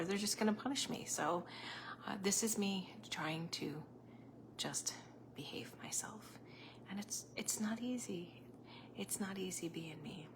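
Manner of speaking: conspiratorial whisper so Facebook won't hear; hushed, mock-guilty whisper; playful whisper. Real, not acted. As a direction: whisper it like a naughty secret. Hiding words from Facebook.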